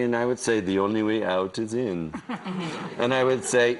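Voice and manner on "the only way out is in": lightly voiced serene guru; a hint of a mystic teacher's calm. Quoting his guru voice to friends, lightly serene. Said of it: "Can you call me with the voice?".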